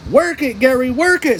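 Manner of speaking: high cheering call from the crowd; cheering yell. A narrator's reading. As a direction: hype him up on the runway. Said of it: "SpongeBob yelled".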